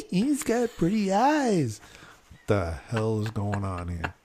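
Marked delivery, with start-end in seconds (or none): 0.1-1.8 higher, slow swooning tone; mock-dreamy wife gushing; husband mimics wife admiring a waiter, higher and slower; imitating his wife. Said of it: "my wife starts".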